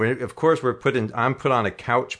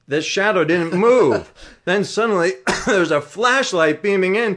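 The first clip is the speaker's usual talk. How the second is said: excited telling; 6 semitones higher, 4 dB louder than usual. Real, not acted. Climax of story, discovered watcher.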